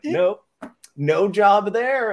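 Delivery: high, slow sing-song no; mock chirpy reply. Podcaster imitating his younger self answering strangers, high. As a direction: chirp it out brightly and slowly. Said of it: "And I'd be like".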